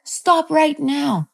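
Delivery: high-pitched urgent mock order; imitated mom pleading sharply; mom's frantic command. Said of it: "she's holding on to my wrist".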